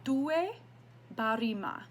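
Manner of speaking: due barima, Ghanaian folk words. Shown as a demonstration. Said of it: "and that is".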